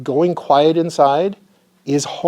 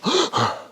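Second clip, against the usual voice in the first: quick sharp hyped-up inhale; sharp gasp; 13 semitones higher than usual. Shown as a demonstration. Teacher showing the Wim Hof inhale: sharp gasp. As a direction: gasp in hard to show the breath. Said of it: "intense long inhale and a short exhale".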